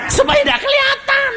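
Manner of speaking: very high sly answer. Acted. Preacher answers for the sinner, very high and sly. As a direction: squeak it out knowingly.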